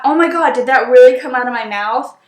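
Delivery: usual speaking voice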